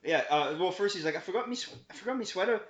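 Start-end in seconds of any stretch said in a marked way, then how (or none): 1.2-2.6 higher-pitched imitation Irish accent; put-on Irish lilt, pitched up. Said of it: "he was too Irish to hear you".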